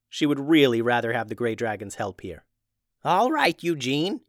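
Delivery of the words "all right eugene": high, lightly voiced old witch; a hint of a patient old woman. Tubes answers Eugene.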